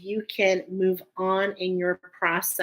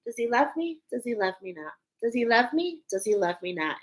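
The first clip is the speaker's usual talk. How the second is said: alternately hopeful and let down; daisy-petal recitation; 3 semitones higher, 4 dB quieter, faster than usual. Acted. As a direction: count off petals in a see-saw lilt. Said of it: "we take daisies and we say".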